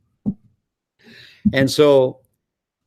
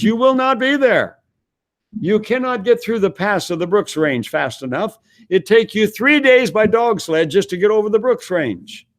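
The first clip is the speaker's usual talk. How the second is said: high-pitched, emphatic mock refusal; insistent local official; 9 semitones higher than usual. Local operator refusing a stranded traveller, insistent and high-pitched. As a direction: lay down the law in a raised pitch.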